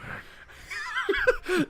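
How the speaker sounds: high-pitched burst of laughter; real high, squealing laugh. Real, not acted. Reacts to snail trails answer.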